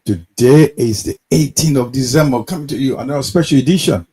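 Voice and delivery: loud broadcast opener; announcer voice. Acted. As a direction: announce the show like radio host. Opens the broadcast show.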